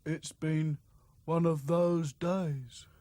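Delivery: slow, hesitant mopey voice; weary doll. A narrator's reading. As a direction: trail off tiredly. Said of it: "said Sad Sack".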